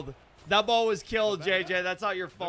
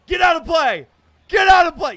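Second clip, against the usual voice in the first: high, loud pleading yell; will the ball foul; urgent shout; 9 semitones higher, 13 dB louder than usual. Real, not acted. Gamer yelling at the ball to go foul.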